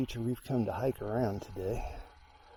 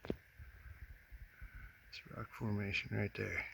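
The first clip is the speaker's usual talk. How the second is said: whisper; 12 dB quieter, much slower than usual. Real, not acted. Pointing out sheep-shaped rock.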